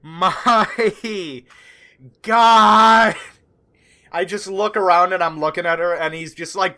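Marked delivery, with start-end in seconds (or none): 0.0-3.1 long drawn-out exasperated groan, getting louder. Acted. Player voices Howland rubbing his temples, exasperated groan. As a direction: stretch every word in weary disbelief. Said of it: "rubbing his temples, just like".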